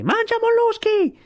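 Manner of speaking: very high, drawn-out taunting yell; shrieked, slow insult; shouting with all might. A narrator's reading. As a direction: yell it high, stretching the words. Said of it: "gridò più forte che poté".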